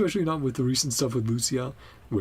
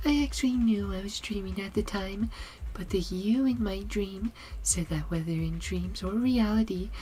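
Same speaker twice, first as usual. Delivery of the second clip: higher put-on game character voice; Seed; 11 semitones higher than usual. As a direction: confide in a friend in an airy character voice. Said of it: "get into my Seed voice as well".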